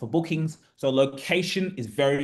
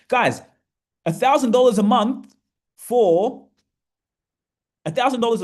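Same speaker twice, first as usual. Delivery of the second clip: higher and louder, hammering the price; excited emphasis; 6 semitones higher, 5 dB louder than usual. Real, not acted. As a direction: sell the number like it's unbelievable. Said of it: "I can get quite passionate".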